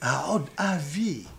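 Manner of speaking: hushed slow Aod Avi; tender, slow. Shown as a demonstration. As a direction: say Aod Avi as if tasting a word long forbidden. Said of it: "ça fait longtemps".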